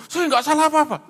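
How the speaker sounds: loud, high indignant outburst; defensive denial. Retold or imitated. Man imitated denying fault when questioned, loudly defensive. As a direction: blurt it out as an exaggerated protest.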